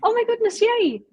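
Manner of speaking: high delighted squeal at a dog; excited cooing. Real, not acted. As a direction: coo over the puppy. Dog reappears on camera.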